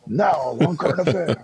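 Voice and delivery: high-pitched mock TV announcer; hammed-up broadcast teaser; current-affairs announcer. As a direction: announce the show like a nineties tabloid programme. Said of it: "like, a current affair".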